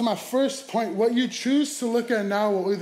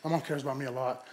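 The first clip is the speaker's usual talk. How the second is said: normal talking voice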